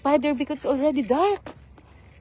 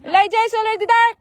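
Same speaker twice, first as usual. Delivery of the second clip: urgent call; 5 semitones higher, 4 dB louder, much faster than usual. Real, not acted. Urging child, fast.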